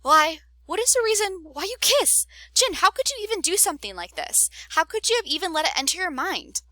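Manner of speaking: high, loud hurt accusation; betrayed outrage. Acted. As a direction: confront a best friend who betrayed you. Wei Chen confronts betrayal.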